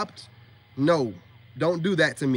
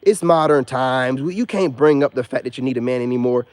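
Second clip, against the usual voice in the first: loud, lower mocking dude voice; mock beta male; 4 semitones lower, 9 dB louder than usual. Goofy dudes online pandering to women, loud low mockery. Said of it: "beta male dudes online talking like".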